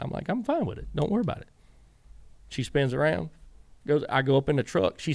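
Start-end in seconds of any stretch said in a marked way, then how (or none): 0.3-1.5 higher, easy reassuring retold reply; light reassurance. Reassuring Danica after qualifying, higher and easy. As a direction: wave off her worry lightly.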